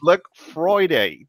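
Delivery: "Friday" drawn out slowly and loud; teasing a colleague's British "Friday"; mock British Friday. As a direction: stretch "Friday" slowly in a mock London accent. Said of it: "That's what he say".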